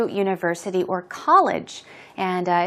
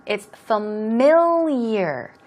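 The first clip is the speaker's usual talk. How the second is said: familiar said slowly, stress on the second syllable mil; slower than usual. Shown as a demonstration. Teacher models familiar slowly, stressing the second syllable. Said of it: "Stress goes right there".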